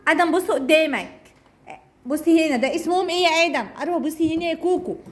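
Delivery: usual speaking voice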